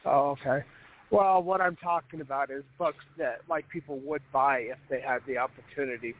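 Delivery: ordinary talk, nothing marked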